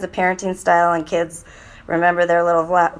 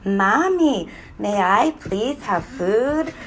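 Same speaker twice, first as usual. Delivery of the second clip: put-on slow, careful polite child's request; exaggeratedly polite and drawn out, lifting at the end; nice asking voice; much slower than usual. Shown as a demonstration. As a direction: model a well-mannered child asking nicely. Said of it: "try it again".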